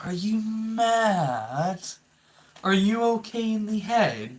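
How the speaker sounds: drawn-out, high, disbelieving question; are you mad, slow and high; incredulous. Real, not acted. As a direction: stretch it out in total disbelief. Repeated incredulous rant question.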